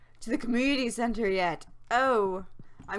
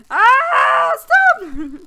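loud, high-pitched scream of alarm; panicked shout; 11 semitones higher, 17 dB louder than usual. Real, not acted. Panics at fishing minigame.

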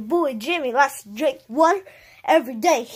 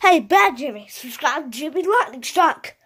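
usual speaking voice

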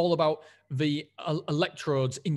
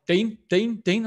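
raised voice calling Dean; calling his name; 6 semitones higher, 6 dB louder, slower than usual. Retold or imitated. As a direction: try to get his attention. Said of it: "they're like".

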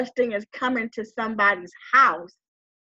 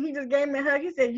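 normal talking voice

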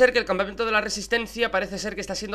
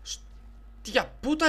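stunned; 8 semitones higher, 5 dB quieter, much slower than usual. Real, not acted. Amazed at invading enemy.

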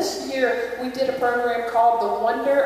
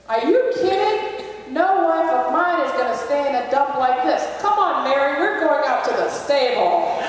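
much higher, louder, outraged husband; indignant Joseph; 4 semitones higher, 5 dB louder than usual. Acted. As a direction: scoff at the dump and sweep Mary out. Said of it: "he goes".

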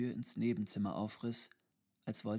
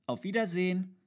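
put-on high, louder child's goodbye; small child; 9 semitones higher, 7 dB louder than usual. A narrator's reading. Narrator voices the small child. (helle, laute Kinderstimme)

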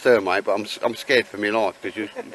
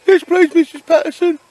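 much higher and louder, like a keen pupil to his teacher; eager schoolboy; 14 dB louder than usual. Pretend. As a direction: squeak it out like a little kid at dinner. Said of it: "I like the way you said".

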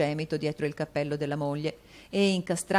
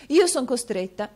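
higher, louder wife's resolve; Madame Mantalini, firm; 9 semitones higher, 7 dB louder than usual. A narrator's reading. Wife announcing her decision, firm. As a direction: lay down the law to her husband. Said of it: "continuò madama Mantalini".

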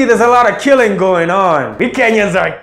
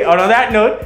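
ordinary talk, nothing marked

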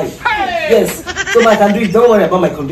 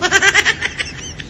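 hysterical laugh; 11 semitones higher, 5 dB quieter than usual. Real, not acted. Actor breaks, laughing.

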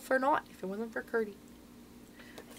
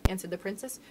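ordinary delivery

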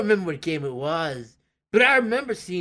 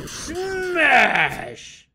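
shout; 10 semitones higher than usual. Acted. Smashing the meteor away.

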